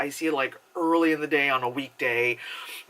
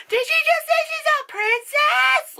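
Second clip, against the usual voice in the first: put-on very high, loud squeaky child voice; little kid voice; 6 dB louder than usual. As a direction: mimic a loud nosy kid in the theater. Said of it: "the little kid behind me is like".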